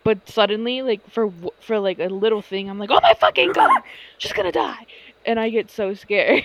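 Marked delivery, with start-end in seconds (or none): 2.9-4.8 high, loud panicked cry; mock panic shriek. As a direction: act out your sudden panic over the cousin. Panicked self quote.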